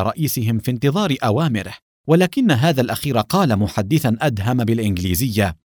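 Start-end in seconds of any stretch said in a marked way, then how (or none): none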